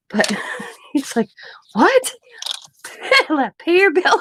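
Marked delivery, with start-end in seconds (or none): 1.7-2.1 high, loud incredulous what; shocked what. Retold or imitated. Neighbor's shocked reaction retold. 3.6-4.2 high, loud imitation of neighbor; retelling neighbor's demand, amused; mock scold retold. Neighbor's line retold, laughing.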